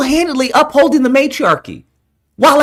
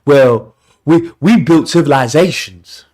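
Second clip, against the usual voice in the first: mock boastful man; 9 semitones lower, slower than usual. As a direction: puff up like a bragging guy.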